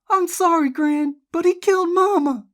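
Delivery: very high, loud outburst; upset accusation. A narrator's reading. Jessie accuses Bobby to her Gran, distraught. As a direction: plead and accuse at full pitch. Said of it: "Jessie accused".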